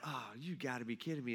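low, soft eye-rolling grumble; exasperated. Acted. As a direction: groan it under your breath. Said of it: "rolling their eyes".

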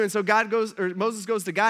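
ordinary delivery

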